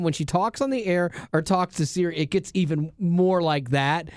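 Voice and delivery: ordinary talk, nothing marked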